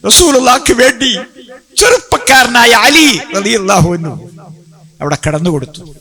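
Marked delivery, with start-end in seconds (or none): 0.1-4.3 impassioned preaching. A narrator's reading. Preacher extols young Ali's sacrifice to the congregation, impassioned and high. Preacher's climax in dramatic story.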